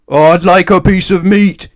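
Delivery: loud, high, slow boom. A narrator's reading. Mister Noisy booms for meat in the butcher's. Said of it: "he boomed".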